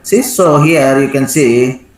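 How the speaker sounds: ordinary talk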